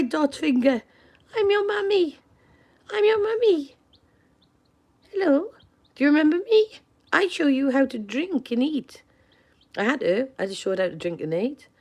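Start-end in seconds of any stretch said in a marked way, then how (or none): 1.3-9.0 put-on high baby-talk mummy voice; sing-song mummy talking to her baby chick; cooing to a chick. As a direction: coo up high like a mother to a newborn chick.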